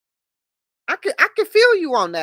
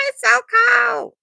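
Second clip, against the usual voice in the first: mock scream; 14 semitones higher, 7 dB louder than usual. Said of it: "I was, like, screaming like".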